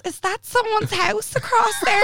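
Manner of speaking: slow, very high woozy voice; dazed. Retold or imitated. [name] imitating her drugged self asking about the hospital, slow and high. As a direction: wonder aloud, completely out of it. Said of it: "And here it was".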